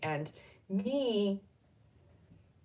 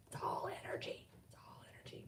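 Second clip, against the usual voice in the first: barely audible whisper; whispered aside; 15 dB quieter, faster than usual. Real, not acted. Reminding herself it's all energy, whispered. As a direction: murmur it under your breath, fading.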